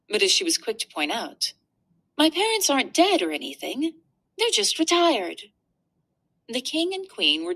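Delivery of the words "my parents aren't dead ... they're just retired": put-on much higher, chirpy girl voice; cheerful young princess setting it straight; little princess voice. Princess reassures about parents.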